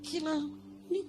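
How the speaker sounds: fighting back tears as he speaks; real tears. Real, not acted. Preacher tells congregation of his son's schooling, through real tears. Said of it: "saya lagi nangis".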